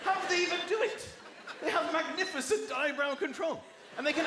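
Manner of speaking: excited. Real, not acted. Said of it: "How cool are these people".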